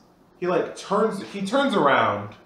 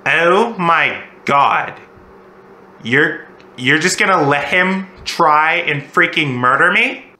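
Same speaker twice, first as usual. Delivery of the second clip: slow, louder disbelief; shocked; 2 semitones higher, 8 dB louder, slower than usual. Retold or imitated. Date staring at Zach after the attack, slow shocked disbelief. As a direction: drag it out in stunned horror.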